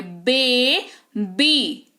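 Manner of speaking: "बेबी" split into a long "bay", pause, long "bee"; "बेबी" as two drawn-out, separate efforts; two separate syllables. Shown as a demonstration. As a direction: break "बेबी" in two, very slowly. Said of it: "दो बार आपके lips".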